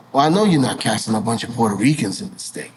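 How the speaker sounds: lightly voiced executive, pitched low; low, measured warning from the network side; disapproving executive. Voices Telemundo side's disapproving call.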